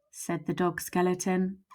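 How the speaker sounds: usual speaking voice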